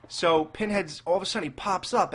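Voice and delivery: normal talking voice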